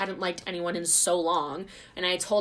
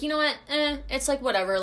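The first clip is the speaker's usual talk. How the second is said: high, louder show of not caring; retold brush-off; 8 semitones higher, 5 dB louder than usual. Retelling how she shrugged off a kiss, airily dismissive. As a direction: act breezily dismissive. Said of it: "But I was like".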